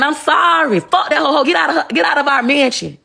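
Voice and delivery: high-pitched mock apology and outburst; acted pleading husband. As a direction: plead like a husband running after his wife. Acting what he should have shouted.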